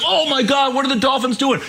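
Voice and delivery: a hint of panicking fans; mock shocked fans. Mocking stunned fans, voice pitched a bit up. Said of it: "They're like".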